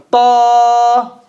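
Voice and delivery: crisp emphatic Ta with a long held vowel; model letter ta. Shown as a demonstration. Teacher modelling Ta for students to judge. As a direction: say Ta slowly and clearly for the class. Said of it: "I'm gonna say it again".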